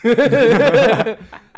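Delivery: high, loud burst of laughter. Real, not acted. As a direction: crack up. Laughing at memory. (放声大笑)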